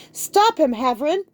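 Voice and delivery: high, loud commanding shout; furious high shout. A narrator's reading. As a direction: yell the order at him. Tara shouts to stop Logan.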